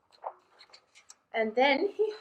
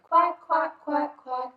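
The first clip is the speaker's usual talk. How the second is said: high, louder quacks; mother duck quacking; 5 semitones higher, 5 dB louder than usual. Acted. Mother duck calling her ducklings, high quacking. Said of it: "Mother duck said".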